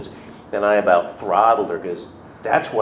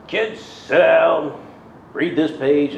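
high, slow classroom command; teacher voice; 8 semitones higher, slower than usual. Retold or imitated. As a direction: address the class firmly.

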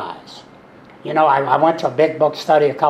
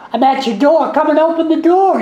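much higher, louder put-on caller voice; mock caller voice; 13 semitones higher, 6 dB louder than usual. Friend pranking him over the phone, high and loud. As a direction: play the prankster luring him to the door, pitched way up.

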